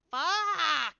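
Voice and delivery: loud, high cry of frustration; retold furious curse; angry shout. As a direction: yell it at the broken game. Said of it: "I was like".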